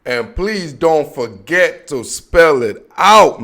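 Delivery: drawn-out slow delivery; slow tagline. Pretend. Host closing his jingle with a slow drawn-out catchphrase. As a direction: stretch it out like a catchphrase.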